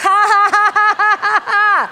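high, full-force ha-ha laughter; loud preaching laugh. Real, not acted. Preacher telling some they need to laugh, loud laughter. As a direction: belt out laughs to infect the room.

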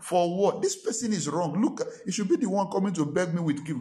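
high-pitched, acted-out objection; indignant protest. Pastor argues with God about gifting an offender, indignant. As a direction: argue back as the wronged party, mock indignant. Arguing back at God's instruction.

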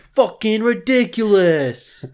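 outraged shout about Subway; furious yell. Acted. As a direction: explode at the absurdity. Escalating rant.

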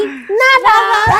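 loud laughing burst; laughing shout. Retold or imitated. Laughing loudly at the galas saying nothing. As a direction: cry it out laughing. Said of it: "yo pensaba".

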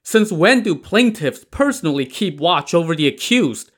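incredulous shout. A narrator's reading. Old mother confronting the jailers about the plaintiff, incredulous shout. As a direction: yell it like she can't believe her ears. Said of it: "shouted incredulously".

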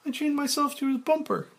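high, fast woman's voice; Claire. Acted. Claire noticing Frank's change, in a high voice.

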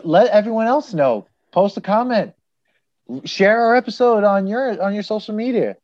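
high, eager plug to listeners; hyped pitch. Real, not acted. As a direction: pump up the audience. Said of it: "pitching to listeners".

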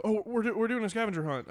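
lightly imitated eager pretext; acted excuse. Acts the girl's improvised excuse.

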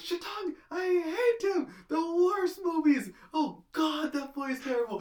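high, crying mom voice; mom impression. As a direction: squeal it like an upset mom. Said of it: "He goes".

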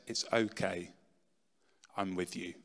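gentle inner voice. Retold or imitated. A voice speaks into his heart.

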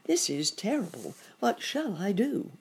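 lightly acted helpless dismay; worried rabbit. A narrator's reading. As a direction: fret with a touch of panic. Rabbit frightened by biting alligator.

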